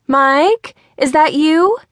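mom calls high and loud through the house; mom calling out. A narrator's reading. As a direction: shout up to her son from inside. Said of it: "Mikey's mom called from inside".

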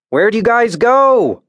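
high, slow call into the dark; shout. A narrator's reading. Emmett calling for the missing group, high slow shout. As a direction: call out long and high into the canyon. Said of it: "Emmett shouted".

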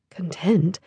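hushed, probing repeat. A narrator's reading. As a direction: drop it softly, almost to yourself. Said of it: "Her voice lowered".

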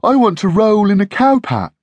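high, loud eager puppy voice; puppy Puzzle barking along with its friend. Acted. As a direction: bark in keen agreement. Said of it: "barked Puzzle".

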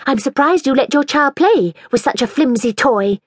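lightly voiced haughty girl. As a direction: look down your nose at the toy. Mirabel's haughty voice.

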